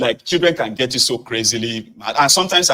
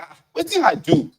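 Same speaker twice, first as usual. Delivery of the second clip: much higher, puzzled wife voice; imitating the wife; 4 dB louder than usual. Wife asking husband what she did, mock-hurt high voice. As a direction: play her baffled and wounded. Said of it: "That will now be like".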